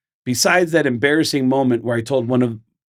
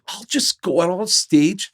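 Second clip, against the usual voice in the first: upbeat, pitched-up mock announcer; put-on stage introduction, higher voice; 5 semitones higher than usual. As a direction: pitch the crowd like a well-meaning rocker. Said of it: "he thought he was doing me a solid".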